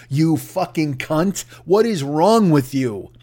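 normal talking voice

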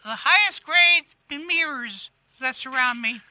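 self-satisfied celebrity impression, pitched above own voice; Kathy Griffin. Celebrity answering interviewer about herself, in a self-satisfied impression. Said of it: "Hey, Kathy".

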